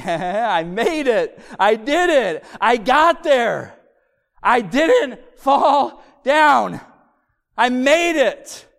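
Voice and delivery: loud, high, slow victory cries; triumphant toddler. Retold or imitated. Toddler who reached dad's arms, imitated triumphant cries. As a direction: proclaim each win as a toddler would, stretched out.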